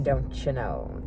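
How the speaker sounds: a drawn-out snide aside, said low and soft; low, slow mocking drawl. Real, not acted. Mocking her self-absorbed husband, low and slow.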